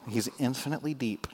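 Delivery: ordinary talk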